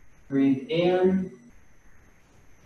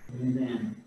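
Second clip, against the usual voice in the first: soft, unhurried breath counting, each cue left to hang; guided breathing cues; 2 semitones lower, 12 dB quieter than usual. Acted. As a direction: guide the class through each breath, quietly. Leads slow breathing cues.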